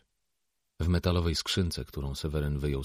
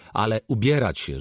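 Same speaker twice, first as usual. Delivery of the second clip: loud, higher parental command; impatient raised-voice order; snapped order; 5 semitones higher, 7 dB louder than usual. A narrator's reading. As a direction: order the kids to dress. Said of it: "rzucił".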